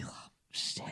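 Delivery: a hushed, scared oh shit; frightened whisper. Retold or imitated. Parents spooked by the child's goodbye, whispering in dread. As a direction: breathe it out with no voice at all.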